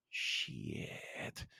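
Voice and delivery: breathy whispered "Shit." under the breath; hushed dismayed whisper; whispered curse. Retold or imitated. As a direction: let the curse slip out as a bare whisper. Muttered reaction in retold scene.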